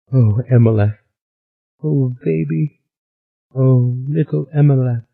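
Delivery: high, tender sobbing lament; a mild sob over her lost baby. A narrator's reading. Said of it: "she sobbed".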